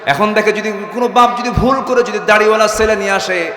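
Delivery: high, loud sermon cry; impassioned high-pitched preaching; full-force preaching. Acted. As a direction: raise the voice and proclaim it to the crowd.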